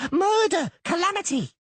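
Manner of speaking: high, loud alarm yell. Acted. Doppler yelling as he rushes out. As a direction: yell the alarm at full pitch. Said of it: "it yelled".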